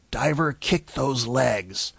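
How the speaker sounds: slow, snarling command. Retold or imitated. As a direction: snarl it out like a drill instructor. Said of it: "the snarling voice again".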